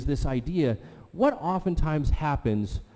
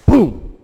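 a sudden loud booming crash imitated; mock boom; 7 semitones higher, 13 dB louder than usual. Retelling the crash heard from the kitchen, a loud mock boom. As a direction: bang it out like something falling.

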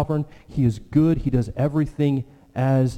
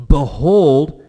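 "Behold" thrown out loud and ringing, a held call; emphatic behold; 3 semitones higher, 9 dB louder than usual. Shown as a demonstration. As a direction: proclaim "Behold" like a herald at full voice. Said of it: "It's emphatic".

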